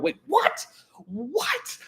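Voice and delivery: high incredulous squeak, laughing; a shocked high-pitched double take with a laugh; disbelief. Real, not acted. Streamer seeing a fan's two-funnel Lusitania, shocked high disbelief. Streamer spots ship model error.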